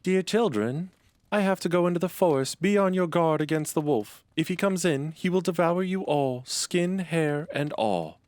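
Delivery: put-on high, fluttery mother goat warning her kids; high mother goat cautioning her children. A narrator's reading. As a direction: raise the pitch high and play the anxious nanny goat. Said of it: "she called all seven to her and said".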